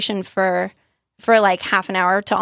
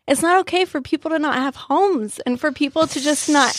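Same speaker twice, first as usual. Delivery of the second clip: put-on child's upset voice; 6 semitones higher than usual. Child's tearful protest quoted.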